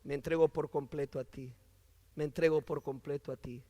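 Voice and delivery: low, soft and intimate; hushed surrender prayer. Acted. Preacher voicing Saulo surrendering to Jesus, hushed. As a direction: pray it softly, low in the voice. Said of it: "le está diciendo".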